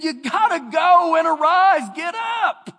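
loud shouted command. Acted. God ordering Joshua to get up, shouting. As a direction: order him to his feet.

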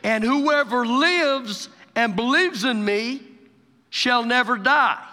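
loud pulpit reading, stretched for weight; emphatic scripture preaching. Real, not acted. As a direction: proclaim each phrase deliberately. Preaches scripture at full force.

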